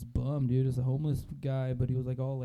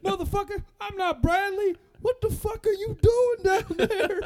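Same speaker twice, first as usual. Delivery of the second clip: hillbilly; 9 dB louder, slower than usual. Retold or imitated. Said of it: "Like, the bum would say that".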